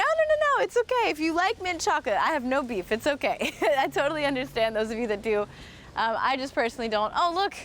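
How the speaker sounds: normal talking voice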